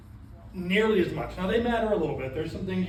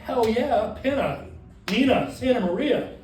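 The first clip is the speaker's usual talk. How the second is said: higher, louder, punchy cheer; excited student; 4 semitones higher, 5 dB louder, slower than usual. Acted. Trainer acting a student thrilled by history class, excited. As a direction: cheer out each ship's name. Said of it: "You're excited about it".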